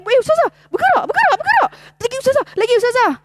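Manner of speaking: high, loud excited calls; excited family. Retold or imitated. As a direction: cry out in excited relief as the arm finally moves. Family excited as arm moves. (seruan teruja keluarga, nada tinggi dan kuat)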